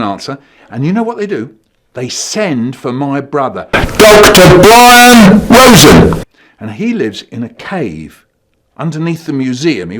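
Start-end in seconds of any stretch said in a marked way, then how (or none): 3.7-6.2 grand slow announcement. Pretend.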